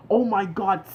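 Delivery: loud, high excited cry of savage; excited exclaim. Retold or imitated. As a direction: hype it up like a fan in awe. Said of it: "And I was like".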